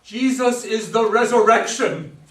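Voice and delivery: loud declaration. Acted. Michael preaching at Joe, loud declaration.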